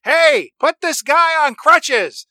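put-on high, slow British accent; a British hotel manager orders staff around, in a high slow British accent. Manager barks an order at staff, British accent, pitched high and slow. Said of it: "who I think is British".

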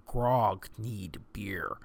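slow, low brute with few words; simple-worded character. Shown as a demonstration. Example of a character using simpler words, low simple voice. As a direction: grunt it out low and slow, word by word. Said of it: "or using simpler words".

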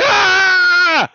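high, loud burning scream; mock scream. Recapping Chucky set on fire, mock scream. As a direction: shriek like a doll on fire. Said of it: "screaming his ass off".

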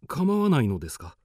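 ordinary talk

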